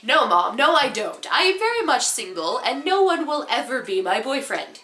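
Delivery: swooping, emphatic mock indignation; indignant protest. Daughter denying three boyfriends to her mom, mock indignant. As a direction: deny it with exaggerated teenage-style insistence.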